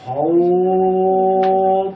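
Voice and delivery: "হও" held long and loud on one steady note; madd at a stop. Shown as a demonstration. Teacher modelling a leen letter before a stop, "হও" drawn out. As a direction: stretch "হও" out, projecting evenly. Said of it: "আমাকে এক আলিফ মাদ করতে হবে".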